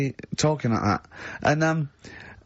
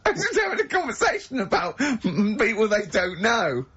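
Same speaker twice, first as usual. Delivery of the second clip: high laugh breaking through the words; giggling through it, can barely get it out; 6 semitones higher than usual. Real, not acted. Host laughing at his own pointless story, much higher and laughing.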